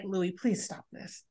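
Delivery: a wife softly begging her husband to stop; pleading hush. Retold or imitated. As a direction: beg him quietly to stop, barely voiced. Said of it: "her way of telling Louis".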